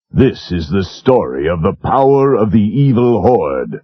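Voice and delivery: ordinary talk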